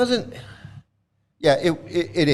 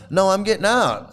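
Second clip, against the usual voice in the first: higher, insistent refusal; retold defiance; 6 semitones higher, 4 dB louder than usual. Passenger refusing to stay in the car, retold defiance. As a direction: re-enact standing your ground, pitched up.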